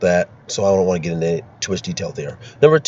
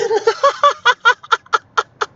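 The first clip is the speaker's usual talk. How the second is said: high, helpless laugh; bursting laughter. Real, not acted. Host failing to keep a straight face, bursting out laughing. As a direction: crack up completely, pitched high. Cracks up after trying the line.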